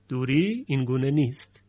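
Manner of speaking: ordinary talk, nothing marked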